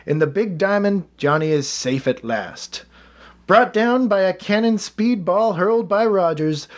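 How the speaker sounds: brighter, livelier reading voice; a lightly performed newspaper reading; reading a newspaper quote. Acted. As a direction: read the old column aloud with some flourish. Reads old eulogy aloud.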